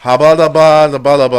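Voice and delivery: mangled name gibberish. Retold or imitated. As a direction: babble the name like you can't read it. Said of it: "and I'm like".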